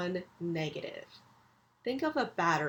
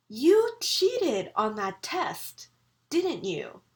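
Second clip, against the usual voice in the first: slow, louder accusing mom, tag falls; angry falling tag; 3 semitones higher, 5 dB louder, slower than usual. Retold or imitated. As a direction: scold like a mother who already knows. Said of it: "Remember how her intonation went down".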